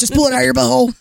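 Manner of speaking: lightly voiced ghoulish prankster; a touch of mock ghoul; Beetlejuice voice. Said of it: "I'm a green A exorcist".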